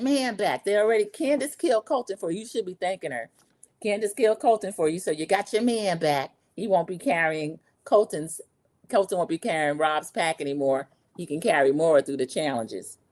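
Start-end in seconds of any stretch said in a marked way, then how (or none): none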